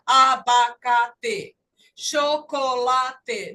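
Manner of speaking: abacate, chocolate chanted evenly, each syllable apart. Shown as a demonstration. Teacher mocks syllable-by-syllable reading of English, evenly chopped chocolate. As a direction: drag out every syllable of chocolate equally. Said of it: "Inglês não é uma língua silábica".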